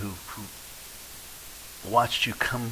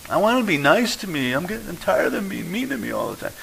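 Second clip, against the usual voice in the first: whiny complainer; 8 semitones higher than usual. Pretend. Voicing a complaining churchgoer.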